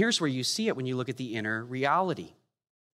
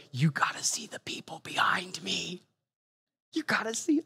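breathy full whisper, toneless and hushed; 4 semitones higher than usual. Shown as a demonstration. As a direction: whisper it as if someone behind might hear. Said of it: "Kinda like this".

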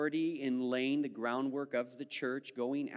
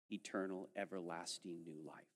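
much softer and lower, hushed; 8 semitones lower, 14 dB quieter than usual. Real, not acted. Preacher hushing to stress eternal life. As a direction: drop to a hush on eternal life. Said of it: "sermon climax softening".

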